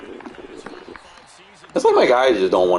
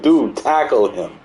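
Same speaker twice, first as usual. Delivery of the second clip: high, drawn-out exasperated plea; slow, raised-pitch frustrated appeal; mad pleading; 7 semitones higher, 4 dB louder than usual. Retold or imitated. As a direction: act out begging your player, stretching the words. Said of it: "I'm mad. I was like".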